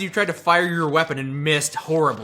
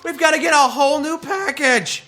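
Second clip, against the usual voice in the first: high, whiny complaining grunt; griping soldier; 10 semitones higher, 4 dB louder than usual. Retold or imitated. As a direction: gripe like a fed-up soldier. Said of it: "soldiers ... are like".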